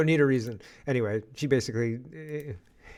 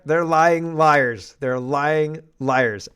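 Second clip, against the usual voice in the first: They're lying liars, dragged out slow and louder; slow scornful emphasis; 3 semitones higher, 7 dB louder, much slower than usual. Real, not acted. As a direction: spell out every word in slow contempt. Scornful repeated accusation.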